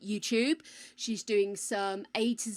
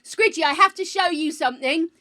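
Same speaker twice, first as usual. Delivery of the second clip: loud, eager calling; excited yelling; 6 semitones higher, 11 dB louder than usual. Real, not acted. Streamer urges Squidgy to see her new guide, excited loud calling. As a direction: call out to get her to look. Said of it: "the only one that can yell here".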